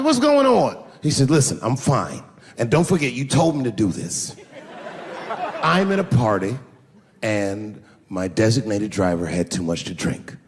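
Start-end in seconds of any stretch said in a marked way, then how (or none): 0.0-0.8 high and loud worry; worried dad. Retold or imitated. Father asking his son at 1 a.m., high and worried. 1.3-10.4 calm-talking son. Retold or imitated. Sixteen-year-old son asking dad for a ride, low and steady.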